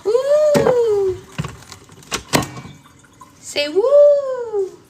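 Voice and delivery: high, loud whooping; big excited woo; loud woo cheer. Real, not acted. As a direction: cheer it out like at a party. Cheering into camera.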